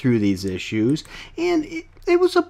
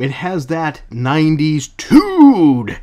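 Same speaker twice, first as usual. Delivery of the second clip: slow, louder, drawn-out attitude; mock tude; 4 semitones higher, 7 dB louder, slower than usual. Reviewer hams up the comic's nineties attitude, slow and loud.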